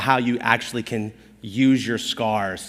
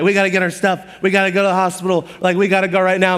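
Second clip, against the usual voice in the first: panicked urgency; 5 semitones higher, faster than usual. Retold or imitated. Panicked rush to hospital.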